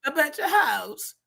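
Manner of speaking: high, slow, drawn-out mimicry; mocking the inflection. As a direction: exaggerate his odd inflection, stretching the words high. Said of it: "what are these inflections?".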